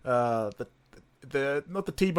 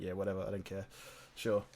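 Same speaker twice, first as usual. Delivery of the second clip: bored bartender; 7 semitones lower, 13 dB quieter than usual. Retold or imitated. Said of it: "They're like".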